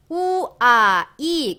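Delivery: 우아이 drawn out as separate u, a, i; slow 우아이, each vowel held apart; vowel breakdown. Shown as a demonstration. Teacher splitting the sound for learners, vowel breakdown. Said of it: "이렇게 발음이 돼요".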